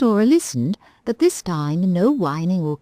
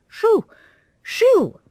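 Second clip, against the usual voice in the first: high, loud shout to scare cats; shouting shoo; 5 dB louder than usual. Acted. Daddy at the window shouts at the noisy cats. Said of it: "looked out and shouted".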